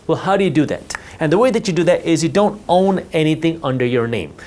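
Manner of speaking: ordinary talk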